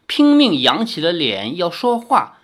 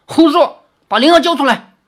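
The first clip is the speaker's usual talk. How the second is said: very high, loud furious shout; 9 semitones higher, 7 dB louder than usual. A narrator's reading. Li Xiaoyao rejects the fox's answer, furious shout. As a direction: roar the demand.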